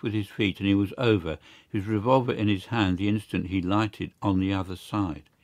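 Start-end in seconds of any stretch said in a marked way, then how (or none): none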